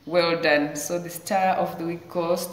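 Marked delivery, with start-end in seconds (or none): none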